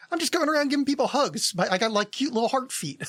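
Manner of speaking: high-pitched, harmless cryptid voice; Hug Wolf. Acted. Voicing a positive cryptid who gives out hugs, high-pitched. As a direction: pitch it up as a friendly creature. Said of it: "a lot of the cryptids are like".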